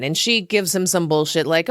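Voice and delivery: normal talking voice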